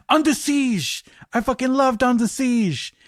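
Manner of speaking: gleeful, hammed-up French accent on "Under Siege!"; bad French accent. Actor delightedly confirms his favorite film, in a bad French accent. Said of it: "excuse the bad French accent".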